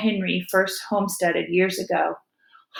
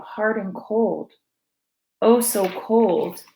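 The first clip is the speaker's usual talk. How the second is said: slow, hushed grief; slow grieving; much slower than usual. A narrator's reading. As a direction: let the grief slow each word. Discovering the dead baby.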